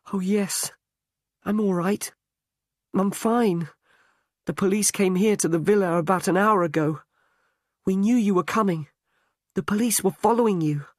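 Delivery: a fiancée gushing with relief, tumbling the words out fast; Angela, reunited with Tom, pours out her relief in a high, fast voice; relieved young woman. A narrator's reading.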